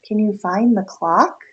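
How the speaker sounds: ordinary delivery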